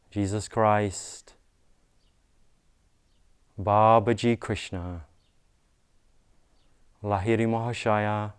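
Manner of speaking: measured devotional invocation with long pauses; prayer invocation. Real, not acted. Leads spoken invocation, repeat after me.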